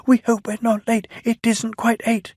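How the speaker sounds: high anxious twitter; fretful little coaches, hurried; twittering anxiously. A narrator's reading. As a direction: Clarabel and Annie twitter nervously. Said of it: "twittered anxiously".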